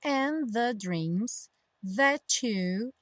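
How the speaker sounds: slow phrase, "that you" merged as "that-chu"; T becoming CH. Shown as a demonstration. Teacher demonstrating the junction, slow "that you" with CH sound. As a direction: draw it out, turning "that you" into "that-chu". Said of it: "o T acabar virando to".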